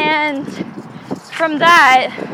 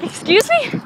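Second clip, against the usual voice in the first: higher-pitched, offended retort; mock indignant; 7 semitones higher than usual. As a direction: toss it back in affronted disbelief. Retold offended reply to insult.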